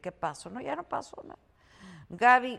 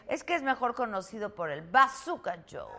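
ordinary delivery

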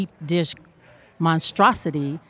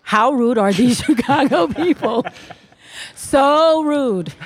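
laughing; 7 semitones higher, 4 dB louder than usual. Acted. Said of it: "Please rephrase".

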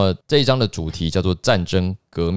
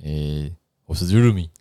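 Japanese-accented introduction; japanese accent in mandarin; 3 semitones lower than usual. Shown as a demonstration. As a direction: say it like a Japanese speaker. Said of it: "这是一个日本腔".

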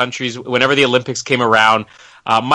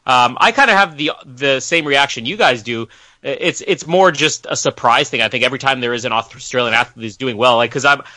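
ordinary talk

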